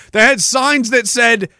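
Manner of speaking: ordinary talk, nothing marked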